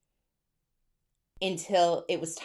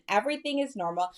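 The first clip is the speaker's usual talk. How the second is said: normal talking voice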